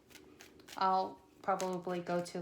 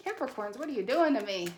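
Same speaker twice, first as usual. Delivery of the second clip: ordinary delivery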